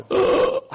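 sharp unvoiced gasp for breath; gasp for air. Sick man gasping in front of the doctor, breathless gasp. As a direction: drag in a ragged breath. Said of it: "I was lying there gasping for breath".

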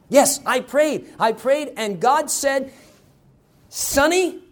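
put-on high, loud, emphatic child's report; excited insistence. Little Sonny telling mama what God said, excitedly insistent.

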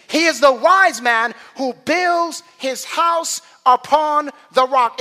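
high, loud pulpit proclamation; forceful preaching. Real, not acted. Preacher declaring the church's foundation, forceful preaching.

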